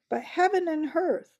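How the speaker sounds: high, loud outburst of dismay; shocked exclamation. Acted. King bursts out in shock at the dwarf's words. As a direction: cry out in alarm.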